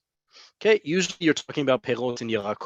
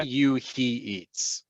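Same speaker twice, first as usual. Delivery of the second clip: ordinary delivery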